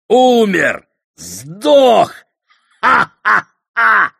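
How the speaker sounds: taunting voice rising to a high, loud shriek; gloat, building to a wild shout and cackle; mocking gleeful shout. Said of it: "Злой дух издевательски захохотал".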